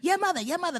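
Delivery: high-pitched, repetitive mock jeer; mimicked taunt. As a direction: imitate a stranger's chanted insult.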